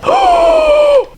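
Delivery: long, very high gasp; big shocked gasp. Real, not acted. Reader realising she flatlined, long high gasp. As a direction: draw in a huge gasp at the twist. Reacts to a plot twist.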